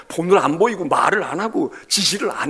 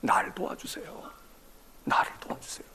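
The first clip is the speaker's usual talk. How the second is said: whispered plea; 7 semitones higher, 7 dB louder than usual. Acted. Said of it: "자기를 도와달래".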